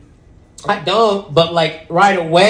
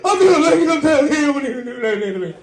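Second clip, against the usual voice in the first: put-on much higher, slow whiny girlfriend voice, laughing; mock crying girlfriend; 8 semitones higher, slower than usual. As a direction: ham up her teary complaint. Imitating girlfriend crying to therapist.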